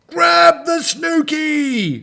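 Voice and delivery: loud, high, drawn-out rescue shout; urgent heroic yell, slowly stretched; crying out. A narrator's reading. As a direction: holler it across the water. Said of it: "cried Gordon".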